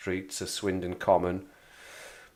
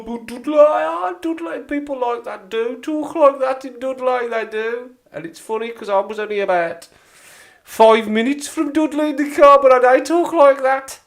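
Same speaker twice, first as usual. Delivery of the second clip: very high, loud hammed-up Dudley accent; 11 dB louder than usual. Shown as a demonstration. Local showing viewers how Dudley people talk, high exaggerated Dudley accent. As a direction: put on an exaggerated Dudley twang, pitched way up. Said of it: "But that's how they talk in Dudley".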